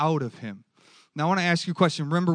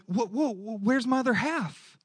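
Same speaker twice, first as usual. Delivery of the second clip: put-on higher, cheeky little girl protesting; playful toddler voice; 8 semitones higher than usual. Preacher voicing his daughter missing her cookie, higher playful protest. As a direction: whine like a toddler who lost half her cookie. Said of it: "She wouldn't talk like that".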